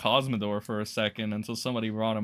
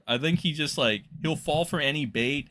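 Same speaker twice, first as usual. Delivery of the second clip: ordinary talk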